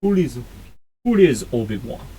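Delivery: put-on second stab at a poor Russian accent; halting, badly faked Russian accent. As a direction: try a Russian accent and fumble it. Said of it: "I can't do a Russian accent".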